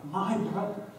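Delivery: a sister's broken, near-whispered plea; grieving sister. Retold or imitated. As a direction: let the words catch in grief. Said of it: "a stronger emphasis from Mary".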